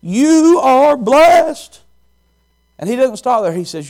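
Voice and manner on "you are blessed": slow, high, loud blessing; joyful proclamation. Acted. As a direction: declare it gladly. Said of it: "Jesus says".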